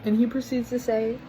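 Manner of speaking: low, slow creepy compliment; creepy man voice. Retold or imitated. Mimicking the customer's creepy compliment, low and slow. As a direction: say it low and leering.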